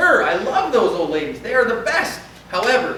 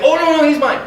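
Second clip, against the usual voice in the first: higher, louder hurried claim; relieved parent; 9 semitones higher, 9 dB louder than usual. Retold or imitated. Parent hurriedly claims the child, high and loud. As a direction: parent rushes in to claim the kid. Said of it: "They'll be like".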